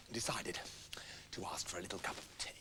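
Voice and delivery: soft breathy whisper for a little cup of tea; whispered storytelling aside. A narrator's reading. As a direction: drop to a hush. Whispered narration line.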